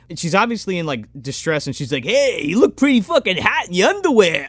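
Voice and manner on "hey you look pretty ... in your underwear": higher-pitched crass come-on voice; mock leering sleazeball. Comic ogling a stranded woman, mock leering. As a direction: imitate an obnoxious guy hitting on someone. Acting the leering comedian character.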